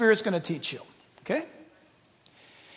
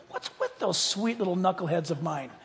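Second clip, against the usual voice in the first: ordinary talk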